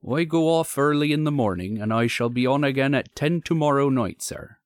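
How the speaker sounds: country signalman confiding reluctantly; troubled signalman. Acted. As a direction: play a troubled, deferential signalman. Signalman's dialogue.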